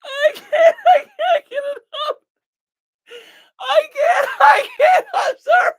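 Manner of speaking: high-pitched, breaking up with real laughter; helpless laughter. Real, not acted. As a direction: laugh so hard you nearly cry and can't finish. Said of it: "if I don't laugh, I'm gonna cry".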